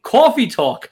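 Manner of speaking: put-on New York accent, pitched high; Linda Richmond. Debater defending New York accents, in a high New York coffee-talk voice. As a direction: stretch the vowels in a showy New York accent. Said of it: "Linda Richmond".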